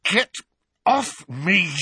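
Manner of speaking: high, loud choked gasp; strained, struggling cry. A narrator's reading. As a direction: gasp it out while being throttled. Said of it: "Harry gasped".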